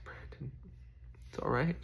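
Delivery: softly whispered reassurance to himself; a hushed, gentle self-reassurance; whispered comfort. Retold or imitated. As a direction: breathe it quietly to yourself. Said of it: "Just like".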